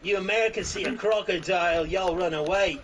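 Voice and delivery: hammed-up Aussie bushman; mock Australian accent. Friend does an outback bloke in mock Australian accent. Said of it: "That's just Australian way".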